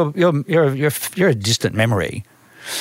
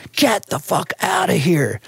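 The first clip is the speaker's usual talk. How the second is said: higher, slow put-on street voice; incredulous New York stranger; 5 semitones higher, slower than usual. Local hears his Australian accent, incredulous New York stranger. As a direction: stretch it out in mock disbelief.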